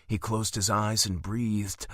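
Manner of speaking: ordinary delivery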